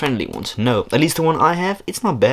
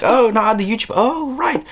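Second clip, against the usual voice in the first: mock surprised self; 12 semitones higher, 3 dB louder than usual. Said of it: "And I was like".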